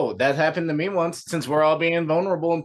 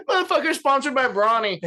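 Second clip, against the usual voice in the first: laughing; 9 semitones higher than usual. Real, not acted. Cracking up at own joke.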